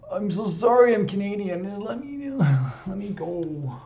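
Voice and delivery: very high, loud, silly squeal; hammed-up pleading apology. Canadian apologising to viewers, hammed-up high pleading. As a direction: plead in a mock-desperate squeak.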